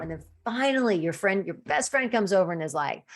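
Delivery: ordinary talk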